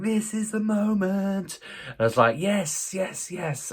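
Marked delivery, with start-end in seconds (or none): 0.0-1.6 very high, drawn-out, held notes; hammed-up, almost chanted heavenly announcement; mock-triumphant elation. Buyer seeing email about his computer, mock-triumphant elation. Acts out thrilled anticipation. 2.4-3.7 high, giddy little cheer; mock celebration, pitched way up; hammed-up excitement. Buyer about to open the email, hammed-up excitement.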